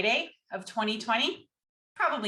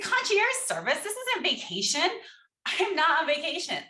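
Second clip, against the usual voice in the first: incredulous outrage; 8 semitones higher, 3 dB louder than usual. Retold or imitated. Retold outraged reply to attorney.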